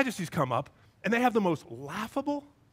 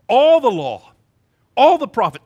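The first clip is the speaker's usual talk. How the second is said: very loud, raised preacher's cry; emphatic proclamation; 4 semitones higher, 12 dB louder than usual. Acted. Jesus declaring what hangs on two commandments, loud emphatic proclamation. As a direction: proclaim it in punched phrases.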